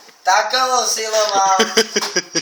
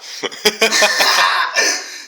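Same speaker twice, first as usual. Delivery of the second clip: laughing. Real, not acted. Laughing at own boast.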